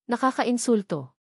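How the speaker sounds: ordinary delivery, nothing marked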